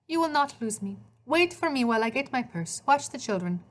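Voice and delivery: put-on Italian accent. A narrator's reading. Maria to Hades before leaving, Italian accent. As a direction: reassure him with an Italian accent. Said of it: "she spoke with an Italian accent".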